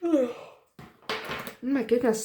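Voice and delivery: words stretched through a real yawn. Real, not acted. Tired vlogger yawning mid-update to viewers. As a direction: yawn out the words, high and drawn. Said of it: "sorry, I'm yawning".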